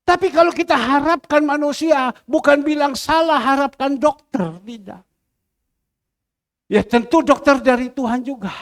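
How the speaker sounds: loud, high-pitched exhortation; forceful preaching. Real, not acted. Preacher urging trust in God over people, forceful. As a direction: drive the point home to the congregation.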